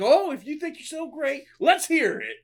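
high, mocking challenge in a put-on dad voice; taunting dad. Retelling the movie, dad taunting his son, hammed-up voice. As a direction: dare him to prove it, sneering.

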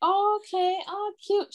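high, put-on polite approval; retold cooing. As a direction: imitate a mother trying to approve. Mother's next reaction, acted.

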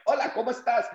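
high mock friendly greeting; Spanish speaker greeting. Stranger greeting him in Spanish, high and friendly. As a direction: chirp the hello up high.

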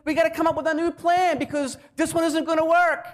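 high, hammed-up frantic fretting; mock panic. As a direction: pretend to be flustered, pitched well up. Mocking a God in panic.

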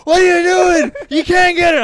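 very high, loud mock tirade; imitated yelling. Border guard yells at traveler looking sideways, high shouting. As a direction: shriek it at someone ignoring you. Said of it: "this guy keeps on yelling".

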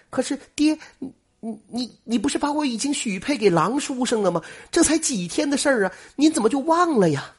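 high, bewildered pleading; puzzled question. A narrator's reading. Daughter asking her father why he forgot her engagement, high and puzzled. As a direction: ask in disbelief, voice well up. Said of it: "瞪大眼睛不解地问".

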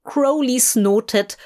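usual speaking voice

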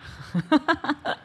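high rising giggle. Real, not acted. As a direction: giggle teasingly. Host laughs at answer.

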